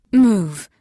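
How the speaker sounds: fast sharp command; quick order. A narrator's reading. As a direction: push her aside urgently. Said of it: "she said quickly".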